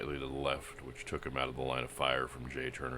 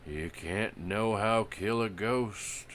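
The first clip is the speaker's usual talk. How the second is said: hoarse, higher outlaw voice; 7 semitones higher, 4 dB louder, slower than usual. Acted. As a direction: rasp it out uneasily. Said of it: "he heard Billy Crane say hoarsely".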